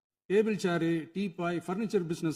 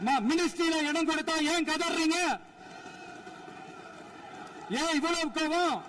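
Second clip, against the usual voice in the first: high-pitched mocking questions; taunting the angry opposition benches; 9 semitones higher, 3 dB quieter than usual. Real, not acted. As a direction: needle the opposition for wailing. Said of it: "கொஞ்சம் சவுண்டா பேசுறேன்".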